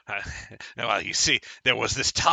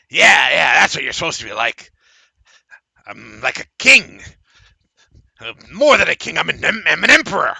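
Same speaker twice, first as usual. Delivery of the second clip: loud, eager bragging; halfling thrilled by the praise; excited boasting; 4 semitones higher, 8 dB louder than usual. Acted. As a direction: puff up like an emperor.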